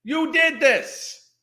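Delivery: slow, pointed yell at her; slow accusing shout. Real, not acted. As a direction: drag out each word as blame. Accusing woman in video.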